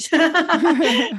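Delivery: bright high burst of laughter; high amused laugh. Real, not acted. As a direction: laugh at your own joke. Laughs at own joke.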